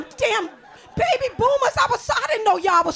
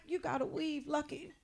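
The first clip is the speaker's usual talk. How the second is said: much softer, teasing under the breath; teasing aside; 3 semitones lower, 16 dB quieter than usual. Retold or imitated. Mother teasing her daughter, soft and sly. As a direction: slip it in quietly like a jab. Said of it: "I'm like".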